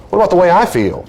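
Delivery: high, aggrieved plea; mock-wounded objection; acted protest. Acted hurt partner protesting.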